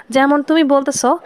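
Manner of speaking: ordinary delivery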